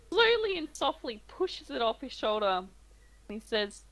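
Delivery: ordinary talk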